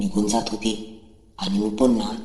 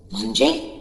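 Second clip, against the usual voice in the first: surprised; 13 semitones higher than usual. Acted. Shocked reply to her confession.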